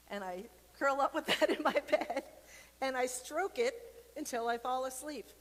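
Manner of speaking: sheepish laughing admission; laughing confession. Real, not acted. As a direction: giggle while admitting it. Laughing at her own confession.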